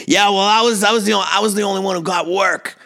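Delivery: high-pitched, put-on protesting dad voice; indignant dad. Dad butting in on the family story, imitated high and indignant. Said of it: "my dad goes".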